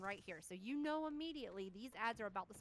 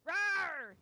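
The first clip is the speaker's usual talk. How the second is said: louder, higher monster roar; dinosaur voice; 8 semitones higher, 11 dB louder than usual. Retold or imitated. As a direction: belt the roar like a beast. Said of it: "what do we hear?".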